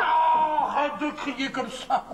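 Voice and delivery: high wail, slowing into complaint; exasperated. Acted. Acted quarrel, exasperated husband.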